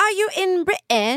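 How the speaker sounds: high-pitched British accent; mock British questioning, high British lilt. Shown as a demonstration. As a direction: put on a playful British accent, pitched well up. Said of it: "British accent".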